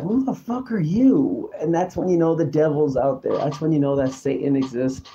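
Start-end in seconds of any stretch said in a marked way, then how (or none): 0.0-1.5 indignant retort. Retold or imitated. His retort to the gossiping voices.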